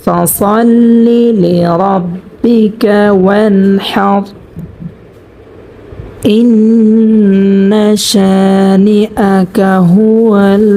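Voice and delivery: slow, melodic tajweed chant; full reading of Surah Al-Kawthar; Quran recitation. Shown as a demonstration. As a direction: recite the whole sura slowly, stretching the vowels. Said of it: "সূরাতুল কাউসার আরেকবার reading পড়ব".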